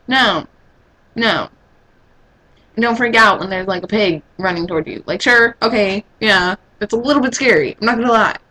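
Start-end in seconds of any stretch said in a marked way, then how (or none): none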